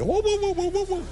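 very high, repeated "uh" hoots; imitated harmonica noise. Comic mimicking Darth Vader's harmonica, high repeated hoots. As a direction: hoot like a wheezing toy harmonica. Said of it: "la armónica que llevaba Darth Vader".

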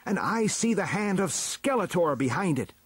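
high, indignant accusation; crying out. A narrator's reading. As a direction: expose the plot with urgency. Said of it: "cried He-Man".

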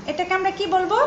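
usual speaking voice

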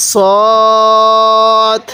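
সদ with its vowel drawn out; elongated সদ in chanted recitation; four-alif elongation. Shown as a demonstration. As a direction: hold সদ long, as in Quran recitation. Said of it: "সদের মধ্যে চার আলিফ".

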